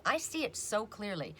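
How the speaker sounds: ordinary delivery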